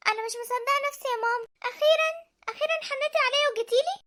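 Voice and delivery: light up at the sight of her; overjoyed greeting. Acted. Daughter to her mother who finally visited, high overjoyed greeting.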